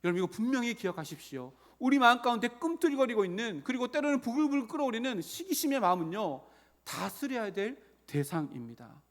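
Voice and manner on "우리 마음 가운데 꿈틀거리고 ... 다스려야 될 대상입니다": raised, loud pulpit urgency; forceful preaching. Real, not acted. Preacher warns congregation about seething jealousy, forceful preaching. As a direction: press the warning on the congregation.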